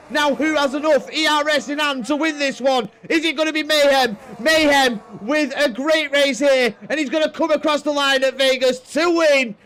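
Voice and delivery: loud, high play-by-play finish; excited race commentary. Acted. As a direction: call the race home at full pitch.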